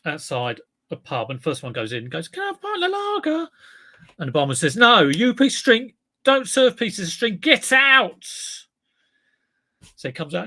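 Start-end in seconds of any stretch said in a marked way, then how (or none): 2.3-3.5 very high, thin little cartoon voice; high-pitched cartoon creature asking for a pint; squeaky string voice. Joke, piece of string character. 4.8-8.2 higher, louder barking barman; hammed-up furious barman; angry barman. Barman throwing string out.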